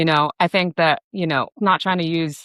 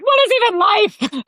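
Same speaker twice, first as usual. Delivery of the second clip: very high, loud existential wail; hammed-up drunken despair; mock drunk crying; 5 dB louder than usual. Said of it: "drunk, crying".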